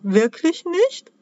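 high, slow, hopeful elf question; timid elf. A narrator's reading. Elf peeking out from behind a cookie, high and hesitant. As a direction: ask it tiny and unsure.

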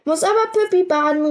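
put-on high, quick toddler voice calling back; a small child protesting from across the room. A narrator's reading. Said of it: "rief das Riesenmädchen Emma zurück". (Kleinkind ruft trotzig zurück, hoch und schnell)

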